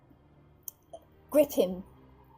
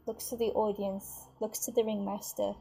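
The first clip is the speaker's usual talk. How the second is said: ordinary talk, nothing marked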